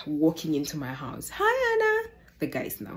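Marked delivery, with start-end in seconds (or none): 1.3-2.1 very high, sing-song greeting; chirpy hi. Retold or imitated. Boyfriend walking into her house, sing-song greeting. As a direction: greet her brightly as if nothing happened.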